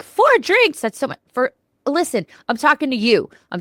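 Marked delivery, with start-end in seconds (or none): 0.0-1.2 high, shocked gasp of disbelief; mock-appalled listener. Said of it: "some of you out there be like".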